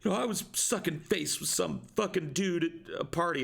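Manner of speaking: high put-on girl voice, whiny and hurt; imitating the upset girlfriend pleading her feelings; mock hurt girlfriend. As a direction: pitch it up like a sulking teen. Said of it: "she'd be like".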